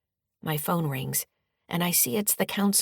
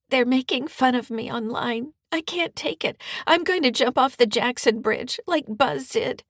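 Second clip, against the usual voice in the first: put-on high, tearful teenage girl on the edge of sobs; weepy voice; 8 semitones higher, 4 dB louder than usual. Bullied daughter tells her mom she can't take it, weepy and high. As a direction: let the tears crack through every word. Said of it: "says in a weepy voice".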